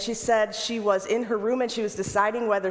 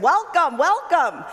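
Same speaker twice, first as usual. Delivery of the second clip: high, loud, joyful greeting; excited welcome; 8 semitones higher, 8 dB louder than usual. Retold or imitated. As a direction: call out a big happy welcome to the arriving group. Nun greeting the arriving bus.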